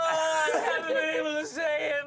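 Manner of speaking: high, stretched-out mock sobbing; hammed-up wailing Esther. Girl gushing to her mom about a crush, high mock wail. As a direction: wail each phrase like a comic crybaby.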